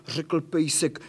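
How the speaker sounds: plain reading voice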